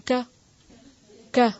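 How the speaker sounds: کا cut short, one finger-flick long; short vowel. Shown as a demonstration. Teacher timing a short vowel for Quran students. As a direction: clip کا quick, no stretch. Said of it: "جتنی دیر میں انگلی کھلتی ہے".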